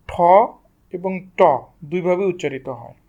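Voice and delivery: normal talking voice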